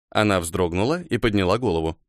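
ordinary delivery

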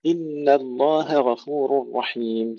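slow, drawn-out chanted recitation; Quran recitation. Acted. Teacher recites the verse to students, slow tajweed chant.

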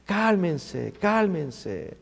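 soft, drawn-out soothing appeal; hushed, unhurried reassurance; God calming people. Acted. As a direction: quietly settle everyone down. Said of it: "Amigos, Dios nos dice lo mismo".

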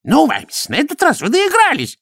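very high-pitched angry bark; barked outburst. A narrator's reading. High priest confronts Vimes over the golem, barking. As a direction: bark it at him as an outraged priest. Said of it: "рявкнул чудакули".

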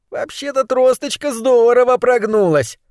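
high, squeezed rat voice; slightly strangled voice. A narrator's reading. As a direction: squeak it out as if pinched. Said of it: "чуть сдавленный голос". (высокий, чуть сдавленный голос)

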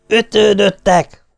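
father calling through the door, mock yelling; yelled. A narrator's reading. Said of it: "ordítottam be nekik".

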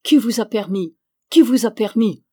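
loud outburst building on the second "Qui"; rising rage. A narrator's reading. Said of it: "il ne se contenait plus".

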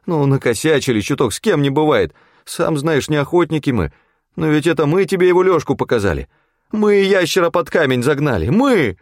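high wheedling crony voice; pleading excuse. A narrator's reading. Федька excusing their slip to the hunter, high pleading. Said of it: "умоляюще проскулил Федька".

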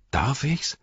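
bright, lifted question of delighted surprise; joyfully surprised. A narrator's reading. Merry answering the king's offer, joyfully surprised. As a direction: ask it high, hardly believing your luck. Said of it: "freudig überrascht".

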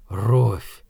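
low, hollow utterance; empty, lifeless. A narrator's reading. Shaken man repeating the woman's name, empty and lifeless. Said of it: "Голос его звучал пусто и безжизненно".

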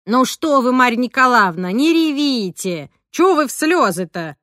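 loud, high, drawn-out remonstrance; fussing consolation. A narrator's reading. Roza tells Marya to stop crying, fussing loudly. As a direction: scold kindly to stop the tears.

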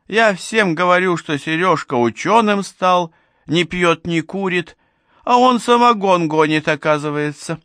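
high-pitched, aggrieved tirade; indignant scolding. A narrator's reading. Old woman berates neighbour for making moonshine, indignant scolding. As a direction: scold in outrage, voice pitched well up.

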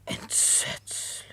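slow, breathless whisper of horror; horrified whisper. Acted. Housekeeper hears of the murder charge, slow horrified whisper. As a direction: let it slip out slowly, appalled.

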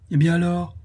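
high-pitched exclaimed question; triumphant relief. A narrator's reading. Madame Guy reacting to the pearls' oddity, triumphant and relieved. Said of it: "d'un ton qui indiquait plus que du triomphe".

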